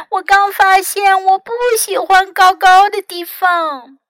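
ordinary delivery

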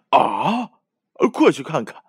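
wide-eyed alarm, hurry; surprised. A narrator's reading. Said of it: "睁大眼睛说".

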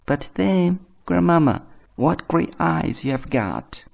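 put-on little girl's high-pitched wonder. A narrator's reading. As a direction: exclaim high like a young child. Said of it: "said the little girl".

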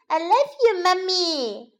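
put-on high, loud little-child bunny voice. A narrator's reading. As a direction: pipe up high to mother. Little bunny tells mother love.

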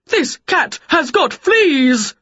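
slow, high, horrified exclaim; appalled judge. A narrator's reading. Judge horrified to find fleas, slow and high. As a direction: recoil in disgust.

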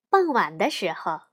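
plain reading voice

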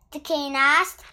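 plain reading voice

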